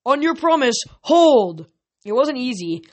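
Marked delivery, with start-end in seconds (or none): 0.0-1.7 high, loud order to halt; raised commander's shout; yelled command. A narrator's reading. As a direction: call the troops to a stop. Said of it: "until Chiron yelled".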